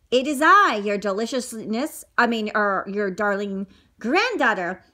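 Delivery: ordinary delivery, nothing marked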